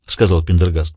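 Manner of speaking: ordinary delivery, nothing marked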